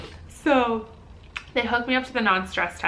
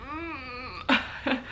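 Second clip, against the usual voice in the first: soft, high strained "mm"; pained hum; 5 semitones higher, 6 dB quieter than usual. Retold or imitated. Woman on the birthing ball breathing through it, pained hum. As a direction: imitate a held-in groan, quietly. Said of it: "I was just like".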